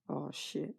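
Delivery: usual speaking voice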